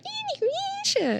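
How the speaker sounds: very high, drawn-out mock squeal; imitated screeching. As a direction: mimic a screeching anime heroine calling out, stretched and piercingly high. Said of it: "Why is she screeching?".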